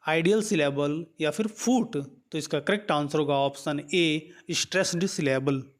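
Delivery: usual speaking voice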